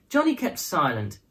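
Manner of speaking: usual speaking voice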